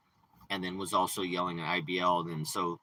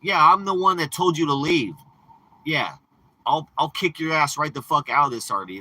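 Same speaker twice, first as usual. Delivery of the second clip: higher, louder angry reply; retold retort; 7 semitones higher, 6 dB louder than usual. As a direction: snap back that he told him to leave. Answering him angrily.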